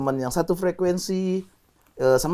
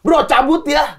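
raised, louder call-out; demonstrated yell; 8 semitones higher, 4 dB louder, much slower than usual. Shown as a demonstration. Calling to a friend on leaving the show, demonstrated yell. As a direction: yell it higher, short of a scream. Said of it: "Gua teriak gitu".

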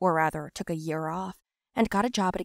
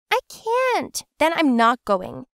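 stubborn refusal; 7 semitones higher, 6 dB louder, slower than usual. Acted.

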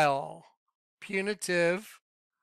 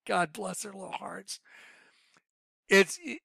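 ordinary talk, nothing marked

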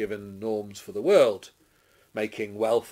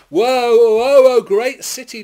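high, loud drawn cry of woe; crying out in lament; 13 semitones higher, 16 dB louder than usual. A narrator's reading. Mourners crying out over the great city, loud lament. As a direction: wail it out over the whole city. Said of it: "weep and mourn and cry out".

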